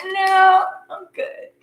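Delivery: dismissive. Pretend. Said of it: "It's like".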